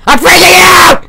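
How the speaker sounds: high, drawn-out freak-out shout; panicked yell. Acted. Hedgehog panicking while trying to hide.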